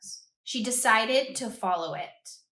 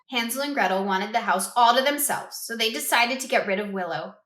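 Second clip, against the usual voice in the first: ordinary delivery, nothing marked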